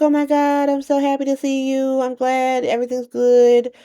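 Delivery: high-pitched mock gushing; gushing greeting. Friends arriving to visit Anna, gushing greeting. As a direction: act out a delighted reunion squeal in words.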